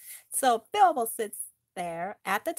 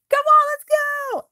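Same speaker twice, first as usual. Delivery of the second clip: high, loud call to run; urgent shout; 9 dB louder than usual. Acted. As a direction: yell at the group to flee. Fleeing the creatures.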